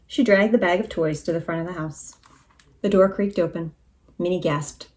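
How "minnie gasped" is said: usual speaking voice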